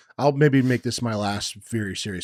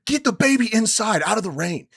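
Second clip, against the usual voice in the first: higher, sharp angry command; retold angry order; 11 semitones higher, 4 dB louder than usual. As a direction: order her inside, fuming. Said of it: "And I was like".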